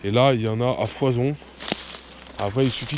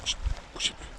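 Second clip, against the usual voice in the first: chuchotement; 10 dB quieter than usual. Real, not acted. Silence pour voir une grenouille.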